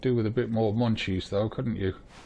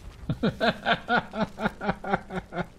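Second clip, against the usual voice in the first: high breathless laughter after escaping; laughing off a close call; relieved laugh; 11 semitones higher than usual. Real, not acted.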